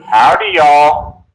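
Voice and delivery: slow, drawn-out Texan howdy; Texan accent. Shown as a demonstration. To friends back home, a slow Texan greeting. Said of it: "what are we gonna talk about, Texas?".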